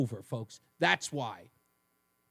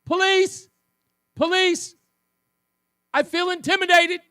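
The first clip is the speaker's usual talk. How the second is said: much higher, loud panicky mock cry; mock yelling for police; 11 semitones higher, 7 dB louder than usual. Host mocking Tong flagging down police, high loud mock panic. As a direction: holler for a cop like a scared coward. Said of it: "waving him down".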